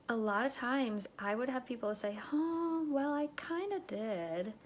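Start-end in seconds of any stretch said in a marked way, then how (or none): 2.3-4.5 high, slow, drawn-out admission; coy hesitation. Retold or imitated. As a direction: imitate a customer coyly admitting it. Imitates hesitant, half-admitting customer.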